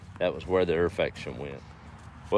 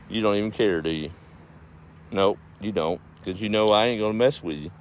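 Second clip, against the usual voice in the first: ordinary talk, nothing marked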